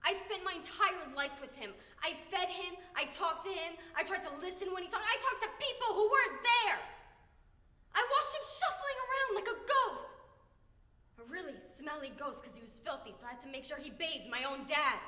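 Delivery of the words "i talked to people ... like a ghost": loud, high exasperated cry; angry outburst. Acted. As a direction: let the grievance burst out loudly. Acted outburst about caring for father.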